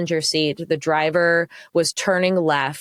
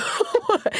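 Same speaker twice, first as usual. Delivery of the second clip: airy laugh, way up high; a light unvoiced giggle; high breathy laugh. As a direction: let out a quick giddy laugh. Retelling her hysterical laughing.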